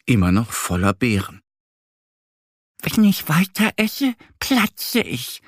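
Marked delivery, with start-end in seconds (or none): 2.8-5.4 much higher, slow stuffed duckling voice; little duck voice. A narrator's reading. Duck Annie holding her full belly, high squeaky voice. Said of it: "meint Annie".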